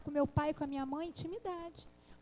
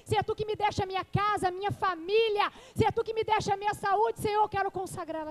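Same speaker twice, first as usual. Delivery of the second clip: prayer that swells from firm to a louder, higher cry; rising passionate prayer to God; building fervent prayer; 3 semitones higher, 8 dB louder than usual. Acted. Prayer offering her Isaac to God.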